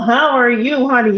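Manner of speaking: ordinary talk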